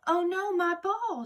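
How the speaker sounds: high, loud, slow cry of dismay; shouted. A narrator's reading. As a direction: yell after the rolling ball. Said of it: "she shouted".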